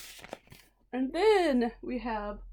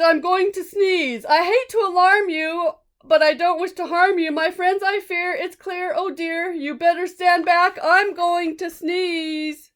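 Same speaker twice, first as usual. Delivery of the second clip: high, loud fretful elephant; a big polite beast fussing about his sneeze; 7 semitones higher, 9 dB louder than usual. Acted. Elephant warning friends to stand back, high and loud.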